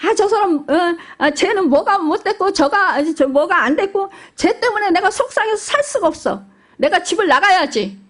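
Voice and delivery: put-on a child wailing about a brother; whining child complaining. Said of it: "이렇게 말한다 합시다".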